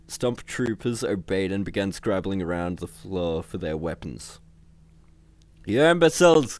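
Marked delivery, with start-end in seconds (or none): 5.6-6.6 high, loud exasperated outburst; angry cry. A narrator's reading. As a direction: berate your incompetent men. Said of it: "cried Visor".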